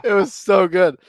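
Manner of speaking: high, loud, fresh out of laughter; delighted amusement. Real, not acted. Storyteller relishing the crash story, delighted. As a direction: savour it after cracking up.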